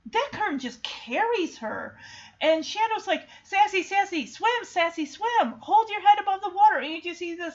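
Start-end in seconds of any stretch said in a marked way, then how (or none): 3.4-6.8 higher, urgent retold cry; imitated anxious coaxing; urgent pleading. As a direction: act out urging someone to keep swimming. Acting Shadow urging drowning cat.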